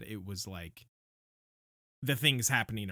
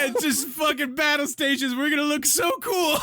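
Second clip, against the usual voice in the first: high, loud, gleeful shouting; hyped-up shout; 5 dB louder than usual. Retold or imitated. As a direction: yell it like an overeager kid soldier. Mock Dothraki battle cry.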